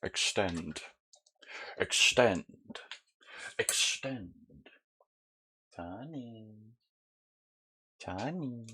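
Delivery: quiet, coaxing pet commands; soft dog commands. Real, not acted. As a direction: murmur it gently to the dog. Softly commanding his pet dog.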